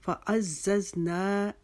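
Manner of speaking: slow फ़अज़्ज़ज़ना with held "naa"; measured model, फ़अज़्ज़ज़ना with stretched end; one-beat long ending. Shown as a demonstration. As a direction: chant फ़अज़्ज़ज़ना, leaning on the double z. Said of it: "एक अलिफ के जितना खींचना".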